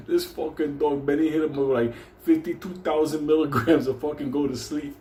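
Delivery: usual speaking voice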